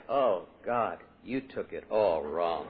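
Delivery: mock-injured denial, slow and low; alcoholic routine. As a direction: play the hurt drunk brushing it off. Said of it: "go into my alcoholic routine".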